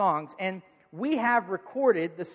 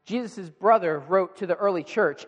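ordinary delivery

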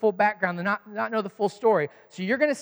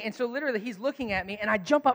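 normal talking voice

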